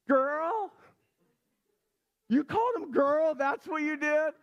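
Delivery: can't believe what she said; amazed dad. Retold or imitated. Dad in disbelief at daughter's comeback, amazed and high.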